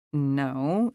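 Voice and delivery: usual speaking voice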